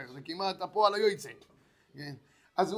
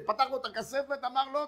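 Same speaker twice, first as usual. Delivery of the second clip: normal talking voice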